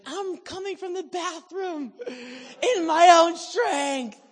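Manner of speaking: loud, high, slow tearful joy through laughter; crying for joy. Retold or imitated. The healed woman tells the preacher, loud tearful joy. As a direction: laugh and sob with overwhelming relief. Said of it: "she's like".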